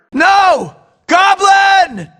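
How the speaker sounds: high, loud cry of the villain's name; desperate yell; desperate shout. Acted. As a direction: scream after him as he flies off. Villain grabs Mary Jane.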